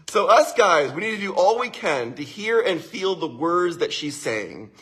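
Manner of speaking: usual speaking voice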